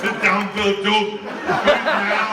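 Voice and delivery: loud, playful order; mock command. Real, not acted. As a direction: boom it out like a joking drill sergeant. Ordering guest to sit, joking.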